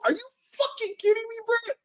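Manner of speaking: squeaky high disbelief; incredulous outburst. Real, not acted. Ranting at Brett on stream, voice cracking high in disbelief. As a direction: voice jumps way up in disbelief.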